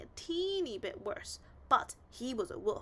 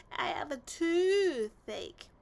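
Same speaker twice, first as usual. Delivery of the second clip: slow, louder whining complaint; complaining wolf; 6 semitones higher, 9 dB louder, much slower than usual. A narrator's reading. Wolf complains to the pigs: slow, louder whine. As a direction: drag it out like a sulky wolf. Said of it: "the wolf complained".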